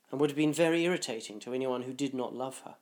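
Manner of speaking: ordinary delivery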